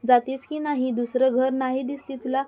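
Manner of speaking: plain reading voice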